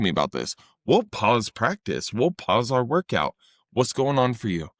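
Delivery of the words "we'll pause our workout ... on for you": ordinary delivery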